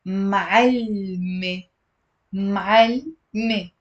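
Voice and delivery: "Ma'alme" with a long l straight into me; drawn-out "Ma'alme" in two separated beats; two syllables. Shown as a demonstration. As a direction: say "Ma'alme" slowly as m'all-me, no vowel after the l. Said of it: "it's gonna be two syllables".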